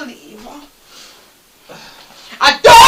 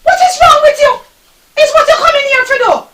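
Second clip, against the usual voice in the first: high, scolding snap; mock exasperated scolding; 6 semitones higher than usual. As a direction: tell him off sharply. Wife scolding husband.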